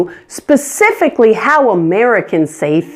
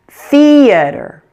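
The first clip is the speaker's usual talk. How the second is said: "theater" with a higher, longer first syllable; stressed first syllable; 6 semitones higher, 3 dB louder than usual. Shown as a demonstration. Teacher showing learners how theater should sound, first syllable stressed. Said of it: "This should be much louder and longer".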